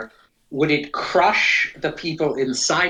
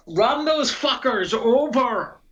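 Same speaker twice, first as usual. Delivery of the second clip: high, drawn-out mock yell; yelled command; 10 semitones higher, slower than usual. Player's character yelling to Thorbrick, drawn-out yell. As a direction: call it out slowly and high. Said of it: "I yell to Thorbrick".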